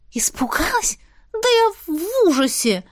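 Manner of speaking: high panicked outburst; horrified. A narrator's reading. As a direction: shriek it in horror. Heroine horrified, answering.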